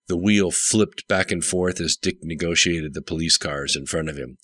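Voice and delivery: ordinary delivery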